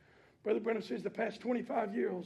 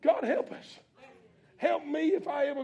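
louder plea; 5 semitones higher, 7 dB louder than usual. Real, not acted. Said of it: "repeated louder, rising".

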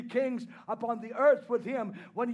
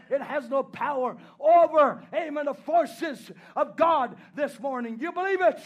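preaching at full force; 3 semitones higher, 7 dB louder than usual. Acted. Preacher to the congregation, swelling into a shouted refrain.

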